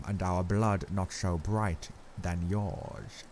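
put-on grave old Dutch professor, accented, measured; Van Helsing's accent. A narrator's reading. Professor gravely telling Arthur Lucy is very bad, accented and grave.

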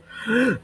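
high, sharp intake of air; gasp for breath. Real, not acted. Player winded from rapid-fire orders, gasp for breath. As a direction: suck in a breath. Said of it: "I'm out of breath".

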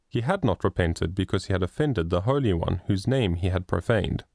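ordinary delivery

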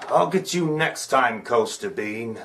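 low muttered villain threat; villain vow. Acted. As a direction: growl it low as you slink out. Rival leaving, vowing revenge.

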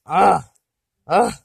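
uh with the vowel through the nose; nasal U. Shown as a demonstration. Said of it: "the nasal U".

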